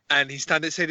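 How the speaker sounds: normal talking voice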